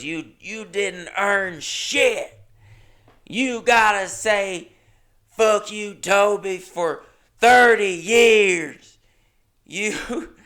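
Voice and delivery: high, loud, drawn-out angry scolding; livid old woman. Retold or imitated. Livid old woman berating the comedian for a joke, high angry yelling. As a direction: tear into them slowly, high and loud. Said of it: "she was livid".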